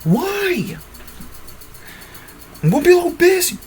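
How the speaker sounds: high cartoon girl line; high female voice. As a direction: pitch way up into a girl's voice.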